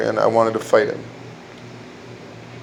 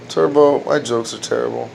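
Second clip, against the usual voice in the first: ordinary delivery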